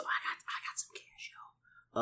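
hushed, unvoiced whisper. Player eyeing the market with cash to spend, whispering. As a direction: whisper it under your breath. Gloats over money before shopping.

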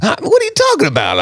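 high, fast, offended comeback; indignant retort. Retold or imitated. Snapping back at the old farmer, high and fast with indignation. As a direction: bristle like a cowboy who's been insulted.

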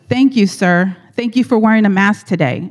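loud, pointedly sweet thank-you aimed elsewhere; pointed fake thanks. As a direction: thank him while glaring at her. Lady makes pointed mask remark.

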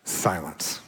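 low, soft single word; hushed. Acted. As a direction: let the word fall into quiet. One-word pause after listing losses.

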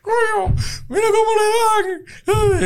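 high, slow, tearful wail; high-pitched crying drunk; drunk crying at the screen. Retold or imitated. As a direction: wail it like a drunk sobbing at a movie. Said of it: "él comienza a llorar".

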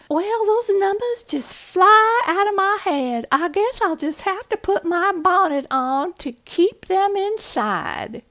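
an over-the-top Southern belle accent, slowing at the end; Scarlett O'Hara. Shown as a demonstration. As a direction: gush it in a Southern belle accent like a silly movie heroine. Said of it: "I love Scarlett O'Hara".

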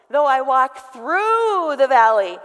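loud, slow, "through" held long; booming emphasis on a stretched "through"; stress on THROUGH. Shown as a demonstration. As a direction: punch "through" and draw it right out. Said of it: "with the emphasis on that word".

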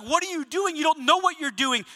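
angry questioning. Retold or imitated. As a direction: imitate a student challenging his teacher. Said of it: "he was angry about".

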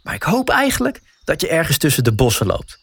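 ordinary delivery

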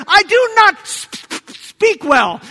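high, loud start breaking into a stutter; stammering. Acted. Moses excuses himself to God, stammering. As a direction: trip hard on the sp of speak. Moses acted as a poor speaker.

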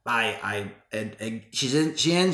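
ordinary delivery

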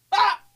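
short, very high "Ah!"; imitated pain yelp. Dad burns his hand at fire, imitated high yelp.

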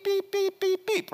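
high, short beeping sounds; imitated key beeps. Dad mimicking the key finder's beeping, high beeps. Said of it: "my keys begin to go beep".

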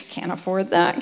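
"Can't afford that" low and soft, sinking away; dropped disappointed aside. Retold or imitated. Wife retelling her Pinterest wishes, low resigned aside. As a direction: let "Can't afford that" fall flat in resignation. Scrolling nursery ideas, disappointed.

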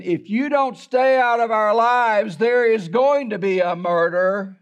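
half-acted shouted warning from the front yard; retold furious threat. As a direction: throw the threat out like an angry man in a truck. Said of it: "he was screaming".

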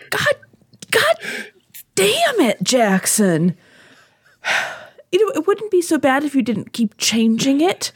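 high-pitched outburst at a prank voicemail; exasperated. Acted. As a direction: sigh and fume, voice jumping up in frustration. Fooled by nephew's prank voicemail greeting.

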